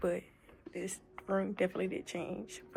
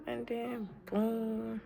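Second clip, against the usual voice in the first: normal talking voice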